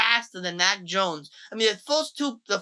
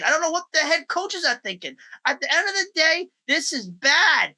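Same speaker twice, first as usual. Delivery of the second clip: exasperated rant; 8 semitones higher than usual. Real, not acted. Ranting about Mac Jones benching.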